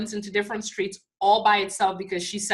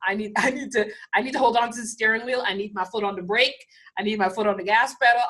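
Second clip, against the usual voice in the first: anxious clinging; faster than usual. Acted. Acting clinging control.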